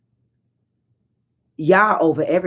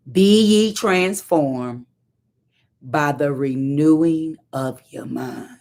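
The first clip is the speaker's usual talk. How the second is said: slow scripture; 3 semitones lower, slower than usual. Shown as a demonstration. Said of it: "scripture quoted slowly".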